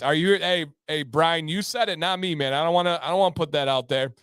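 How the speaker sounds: ordinary talk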